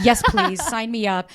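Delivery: gleeful, playful sign-me-up; thrilled. Retold or imitated. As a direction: jump at the offer with delight. Thrilled reaction to his text.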